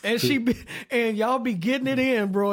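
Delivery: ordinary delivery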